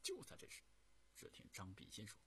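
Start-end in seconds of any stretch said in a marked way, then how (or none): none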